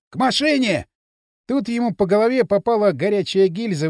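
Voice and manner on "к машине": shout. A narrator's reading. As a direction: yell it to the others. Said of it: "закричал он". (громкий окрик)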